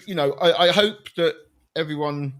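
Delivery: ordinary talk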